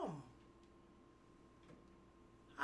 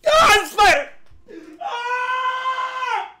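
high, loud yelp into a long shriek; startled scream; 10 semitones higher, 4 dB louder, slower than usual. Real, not acted. Streamer spots a spider, screaming. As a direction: cry out in sudden alarm, very high.

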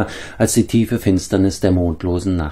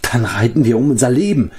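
raised, urgent cut-in; interjection; 5 semitones higher, 3 dB louder than usual. A narrator's reading. Bard cuts into the escape plan, mildly urgent. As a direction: jump in with mild urgency. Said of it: "warf Flavdur ein".

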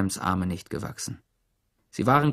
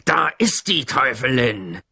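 loud, accusing bellow; roaring; 5 dB louder than usual. A narrator's reading. Trout Walker denouncing the teacher to the mob, roaring. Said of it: "brüllte Trout".

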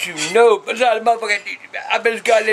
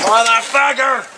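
slow, higher furious curse; raging as garbage juice spills; angry outburst; 4 semitones higher, 4 dB louder, slower than usual. Real, not acted. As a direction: let it rip at the spilled garbage. Garbage bag bursts, he rages.

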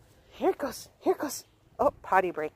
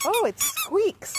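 delighted discovery; 11 semitones higher, slower than usual. Real, not acted. Discovering toy squeaks.

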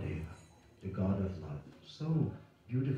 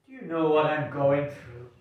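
louder plea, falling at the end; a lightly voiced troubled character, falling tune; distressed plea; 2 semitones higher, 4 dB louder than usual. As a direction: pour out the trouble, heavy ending.

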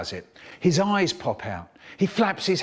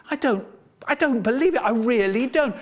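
high, upset disbelief; 7 semitones higher than usual. Retold or imitated. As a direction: voice pitched up and pushed, close to tears. Crying friend in disbelief.

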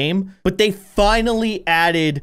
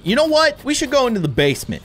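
sudden idea; 8 semitones higher, 4 dB louder, faster than usual. Real, not acted. Sudden idea in a spooky house.

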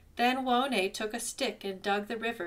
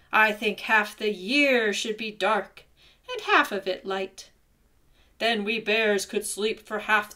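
deep gruff bear; 7 dB louder than usual. A narrator's reading. Bear tells tiny ant in a loud gruff voice. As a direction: boom it out like a lazy big bear. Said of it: "said in a deep, gruff voice".

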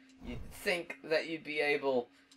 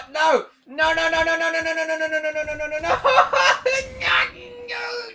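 rapid-fire high, loud string of cries; panicked shouting; 14 semitones higher, 12 dB louder, faster than usual. Real, not acted. Player about to die in a game, panicked shouting. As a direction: yell a nonstop stream of panic.

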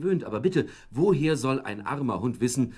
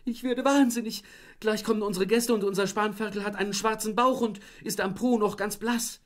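very high, louder wailing lament; wife wailing about the burnt piglet; wailing complaint; 13 semitones higher, 5 dB louder than usual. Acted. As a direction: wail in despair over the ruined roast. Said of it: "das immerfort jammerte".

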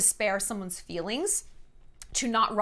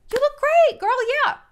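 high, loud, overbright praise; gushing fake compliment; 8 dB louder than usual. To a friend asking how she looks, high loud gushing.

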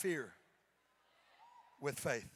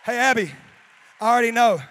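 calling out for applause; 6 semitones higher, 20 dB louder than usual. Real, not acted.

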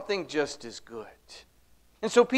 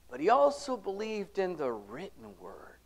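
sudden hushed whisper; an intimate sermon whisper; 8 dB quieter than usual. Real, not acted. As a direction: lean in and whisper it. Preacher drops to whisper.

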